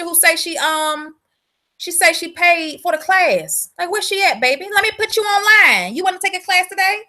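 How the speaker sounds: usual speaking voice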